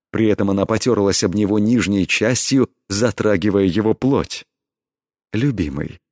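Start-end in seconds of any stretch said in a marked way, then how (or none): 5.3-6.0 soft, breathy endearment. A narrator's reading. Heroine coaxing her lover on tiptoe, breathy. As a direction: murmur it on the breath, tenderly. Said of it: "с придыханием произнесла она".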